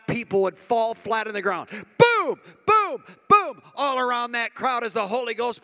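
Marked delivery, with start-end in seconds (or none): 2.0-3.6 high loud boom, boom; booming sound effect. Pretend. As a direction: shout each boom like an impact. People falling, sound effect.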